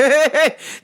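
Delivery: high, loose real chuckle. Real, not acted. Teasing a rich man about trading places, chuckling. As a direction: laugh at your own jab. Laughing at own jab.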